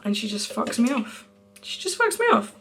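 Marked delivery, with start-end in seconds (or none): 1.6-2.5 very high, squeaky disbelief; mock outrage. Complaining to viewers about a friend's snub, mock-outraged squeak. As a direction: repeat it way up high, indignant.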